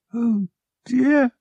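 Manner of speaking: high, dismayed little exclamation; fretful dismay. A narrator's reading. Mother wringing her hands, fretful dismay. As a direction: fret it in a high voice.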